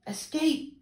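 very high yelped word; barking dogs. A narrator's reading. Circus dogs urging the mermaid to escape, high yelps. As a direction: bark it out like an excited dog. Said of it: "barked the dogs".

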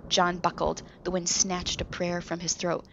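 ordinary delivery

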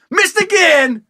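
high, loud comic jeer; mock taunting holler. Target heckles the bad shot, mock taunting holler. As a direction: jeer it across the street. Victim taunts the musket shooter.

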